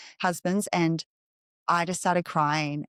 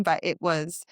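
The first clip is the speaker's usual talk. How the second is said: normal talking voice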